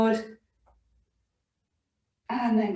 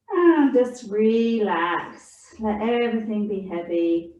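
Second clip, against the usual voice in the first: soothing relaxation guidance. Acted. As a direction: ease them gently into rest. Soothing relaxation in child's pose.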